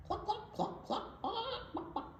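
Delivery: high, soft playful clucks with a laugh; hen clucking. Acted.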